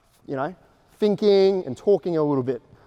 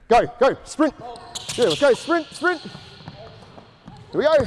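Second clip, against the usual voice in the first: calling out; 6 semitones higher than usual. Real, not acted. Coach calling out during drill.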